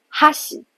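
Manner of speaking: 哈喜 high on 哈, falling on 喜; 哈喜 with pitch falling after 哈; head-high accent. Shown as a demonstration. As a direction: stress the first mora of 哈喜 and drop. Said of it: "重音在开始的第一个假名哈上面".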